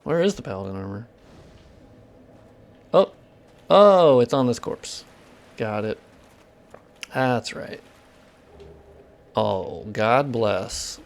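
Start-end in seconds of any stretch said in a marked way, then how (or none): none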